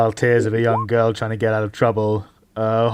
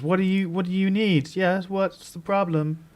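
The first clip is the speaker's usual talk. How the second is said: put-on guilty young woman; 7 semitones higher than usual. Celia answering the inspector, high and guilty-innocent. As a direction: play innocent while sounding caught. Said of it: "she looks guilty and says".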